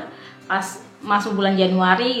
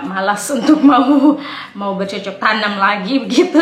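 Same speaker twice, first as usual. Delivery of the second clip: talking through a laugh, louder; 7 dB louder than usual. Real, not acted. Vlogger joking about trying for a baby again, laughing. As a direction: let amusement break into the words.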